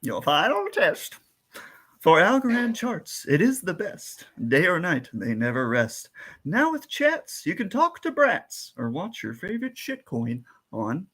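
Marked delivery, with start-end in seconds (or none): 2.0-11.0 slow, rhythmic verse reading; reading a limerick. Acted. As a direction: recite the rhyme with a bouncing beat. Reads a limerick riddle.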